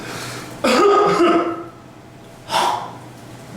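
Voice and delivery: forceful demo cough to clear sputum; demonstration cough. Shown as a demonstration. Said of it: "let me demonstrate this first".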